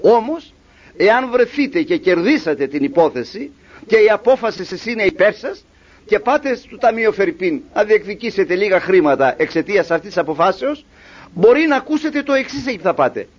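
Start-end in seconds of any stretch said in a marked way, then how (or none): none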